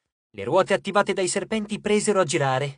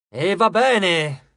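exclaimed; 6 dB louder, much slower than usual. A narrator's reading. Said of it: "esclamò".

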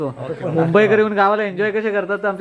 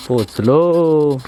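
drawn out; 3 semitones lower, 4 dB louder, much slower than usual. Pretend. Drawn-out announcement of arrival.

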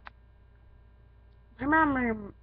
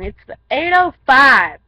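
loud correction; 3 semitones higher, 15 dB louder, slower than usual. Real, not acted. Correcting the time loudly.

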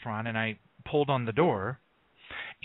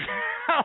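a high squeal of a laugh; sudden laugh. Real, not acted. Host breaks off laughing at the puppies, high laugh. Distracted by the dogs mid-show.

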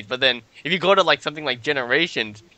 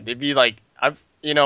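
usual speaking voice

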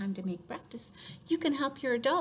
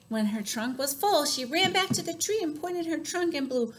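narration rising higher towards the big blow; animated storytelling; 6 semitones higher, 4 dB louder than usual. A narrator's reading. Storyteller to children as the trunk fills, building excitement. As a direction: build the suspense as the pitch climbs.

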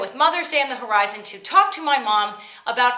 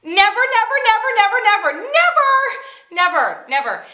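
high, loud repeated never, climbing higher; emphatic never; 10 semitones higher, 5 dB louder than usual. Acted. Realizing she'd never bring it up, loud and high. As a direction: hammer each never harder. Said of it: "and I realized".

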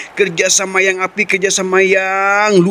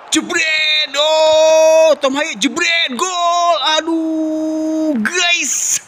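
goal call; 8 semitones higher, much slower than usual. Real, not acted.

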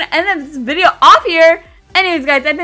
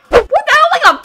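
indignant surprise; 11 semitones higher, 3 dB louder than usual. Real, not acted. Game result surprises.

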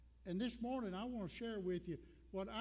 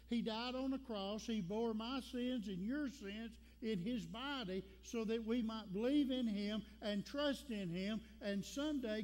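full-force preaching; 3 semitones higher than usual. Acted. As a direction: proclaim it with all your heart.

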